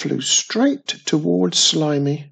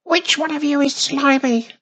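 tiny witch voice; 13 semitones higher, 4 dB louder than usual. A narrator's reading.

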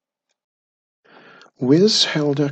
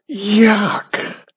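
high, louder disgusted squeal; 7 semitones higher, 6 dB louder than usual. Acted. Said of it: "squealed Wizardy Witch".

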